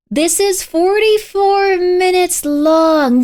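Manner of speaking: drawn out in disbelief; slow, stunned realization. Real, not acted. Reacting to the video's length.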